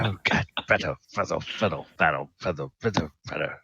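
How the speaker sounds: soft, repetitive panicky mumble; frantic muttering. Acted. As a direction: mutter to yourself while hurriedly bandaging. Acted frantic bandaging of own wounds.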